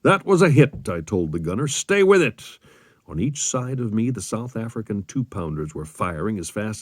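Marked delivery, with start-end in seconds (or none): 0.0-0.9 high, loud battle call; raised commander's shout; calling to the gunner. A narrator's reading. 1.7-2.6 high, loud command; urgent raised order; urging the gunner on. A narrator's reading. Commander urges gunner on in battle.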